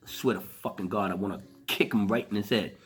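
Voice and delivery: usual speaking voice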